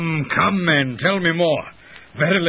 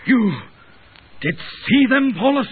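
shocked; 9 semitones higher than usual. Acted. Husband shocked by eyewitness claim.

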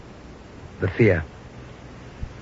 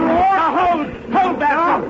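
urgent command; slower than usual. Acted. Urgent orders during charge.

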